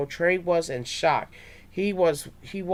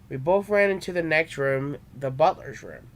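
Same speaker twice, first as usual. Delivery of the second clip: ordinary delivery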